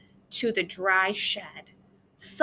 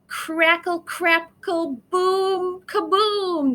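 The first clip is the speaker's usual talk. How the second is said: louder and higher thunder sounds; crackling thunder; 7 semitones higher, 5 dB louder, slower than usual. A narrator's reading. Narrator acts out the thunder crackling over the shed. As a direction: crackle then boom like the sky splitting.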